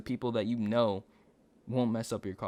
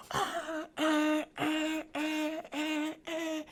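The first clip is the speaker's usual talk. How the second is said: mimicked horn; 3 dB quieter, much slower than usual. Imitating car horn.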